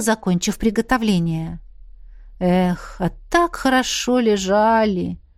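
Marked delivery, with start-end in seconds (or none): none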